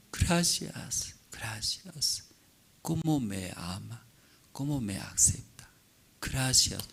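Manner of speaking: soft, breathy, humbled gratitude; hushed grateful thanks. Acted. Wife thanking the husband who forgives her, hushed thanks. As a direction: whisper your thanks, overwhelmed.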